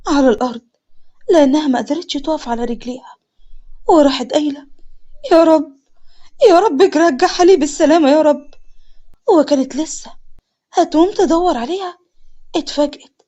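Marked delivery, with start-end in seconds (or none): 5.3-8.5 loud, tearful plea to God; distraught prayer. A narrator's reading. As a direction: beg desperately for the child's return. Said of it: "من كتر الصدمة". (بتضرع وعياط)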